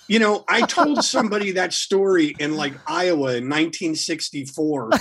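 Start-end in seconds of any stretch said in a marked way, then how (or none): none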